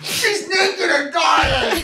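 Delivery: high, loud, drawn-out mock shout; hammed-up cry. Re-enacts favourite line from an episode.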